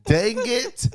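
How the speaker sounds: high-pitched comic letdown through laughter; laughing disappointment. Real, not acted. Co-host denied the British accent, laughing in disappointment. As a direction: squeal it, cracking up at being denied.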